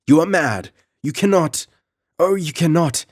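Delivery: high, loud desperate cry; frantic pleading. Acted. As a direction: beg him to stop, horrified. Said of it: "shrieked Belisa".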